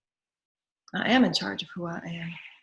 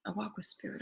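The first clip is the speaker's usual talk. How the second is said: quiet, inward affirmation; soft spoken affirmation; 9 dB quieter than usual. Acted. Speaker affirming walking with spirit, softly. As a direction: murmur it to yourself softly.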